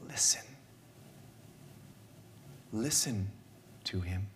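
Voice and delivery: preacher quoting the Father's words, soft and hushed; soft earnest quote. Retold or imitated. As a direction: say it softly and gravely, with long pauses. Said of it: "The Father says".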